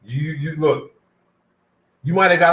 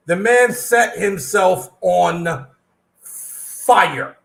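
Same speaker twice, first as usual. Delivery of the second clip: very slow emphasis; much slower than usual. Real, not acted. Stressing the shocking news.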